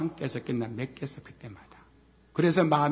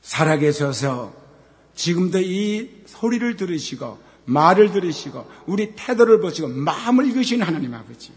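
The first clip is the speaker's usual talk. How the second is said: prayer; 3 semitones higher, 7 dB louder than usual. Real, not acted. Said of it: "기도드리겠습니다".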